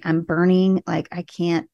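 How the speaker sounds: usual speaking voice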